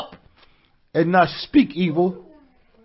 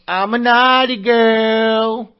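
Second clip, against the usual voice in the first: mock naughty girl; 3 semitones higher, much slower than usual. Mock quote after Valentine's joke.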